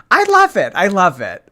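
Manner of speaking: high, loud squeal of delight; excited delight. Real, not acted. Thanking a donor, loud and delighted. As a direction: squeal with joy.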